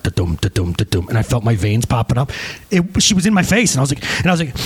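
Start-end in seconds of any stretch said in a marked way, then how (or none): 0.0-1.1 very low heartbeat imitation; pounding heartbeat. Employee's heart pounding under boss's rant, low thudding imitation. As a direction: thump it out deep and even. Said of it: "That was my heart".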